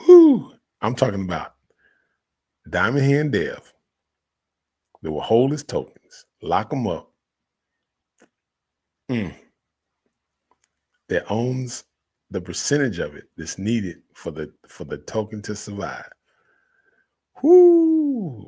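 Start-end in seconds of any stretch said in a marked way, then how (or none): 0.1-0.5 loud high whoo of joy; excited whoop. Real, not acted. Crypto hype celebration. 17.4-18.4 loud high whoo of joy; excited whoop. Real, not acted. Crypto hype celebration.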